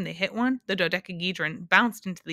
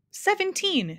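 shouted; 13 semitones higher, slower than usual. A narrator's reading. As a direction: shout out a wild answer, high. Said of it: "shouted the humbug".

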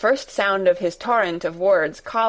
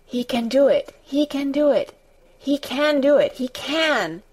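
gabbling to herself; 5 semitones higher than usual. Acted. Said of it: "she gabbled over to herself".

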